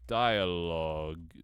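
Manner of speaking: dialogue stretched very slow, syllable by syllable; drawn out. Shown as a demonstration. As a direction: spell out dialogue slowly as you type. Typing the word out slowly.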